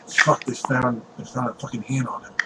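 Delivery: very soft, worried undertone; hushed aside. Acted. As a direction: confide bad news under your breath. Parents confer privately in alarm.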